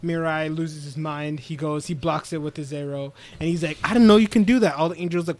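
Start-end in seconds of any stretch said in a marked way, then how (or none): 3.8-4.7 higher, louder imitation of disbelief; retold outburst of surprise; astonished retelling. Retelling an anime character caught off guard, astonished.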